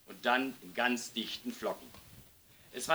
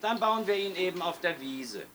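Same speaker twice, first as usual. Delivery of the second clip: put-on carefree child in a higher pitch; unconcerned; 6 semitones higher than usual. A narrator's reading. Lisa offers the caretaker the meadow, unconcerned. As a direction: pitch it up, not bothered at all. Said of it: "sagte Lisa unbekümmert".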